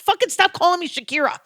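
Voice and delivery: high-pitched exasperated demand; angry retort. Retold or imitated. Fed up tourist scolding shouting vendors, pitched high. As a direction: tell them off, voice jumping up. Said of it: "I get even more mad".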